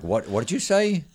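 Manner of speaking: high-pitched, baffled double-take; baffled reaction. Retold or imitated. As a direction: act out not believing what you heard. Acting out a baffled restaurant exchange.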